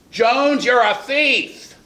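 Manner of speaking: high, drawn-out shout through thin walls; very loud accusation. Acted. Accusing Jones in a closed office with thin walls, very loud. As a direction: shout it so it carries beyond the walls. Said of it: "And I say it very loudly".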